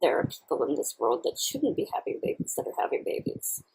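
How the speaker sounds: ordinary delivery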